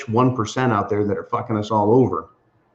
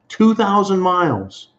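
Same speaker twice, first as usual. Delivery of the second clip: Two said high and slow, stretched out; emphatic high repeat; 9 semitones higher than usual. Real, not acted. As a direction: stress two thousand, rising high. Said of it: "repeated for emphasis".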